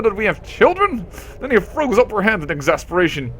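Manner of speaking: hammed-up furious telling-off; mock fury. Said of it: "fury rises another notch".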